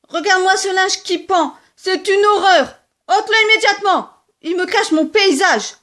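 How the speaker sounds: high, loud scolding; angry quarrel. Acted. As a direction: order the washing down. Rabbits quarrel. (dispute furieuse, aiguë et forte)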